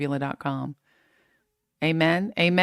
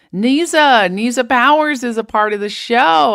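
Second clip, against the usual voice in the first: higher and louder, thrilled to see her; excited greeting; 6 semitones higher, 10 dB louder than usual. Real, not acted. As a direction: call out her name with delight. Greeting a viewer by name.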